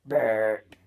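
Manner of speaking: a loud, low belched word; ugly burp. Shown as a demonstration. Kid showing viewers his ugly burp, loud and low. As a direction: force the word out as a big burp. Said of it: "is called the ugly burp".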